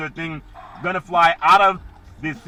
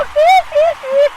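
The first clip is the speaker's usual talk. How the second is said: high falsetto squeal; 8 dB louder than usual. Pretend. Mid-impression squeal.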